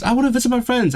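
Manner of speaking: high, sudden pleading whine; suddenly yearning to go see friends; excited whine. Real, not acted. Sudden longing outburst.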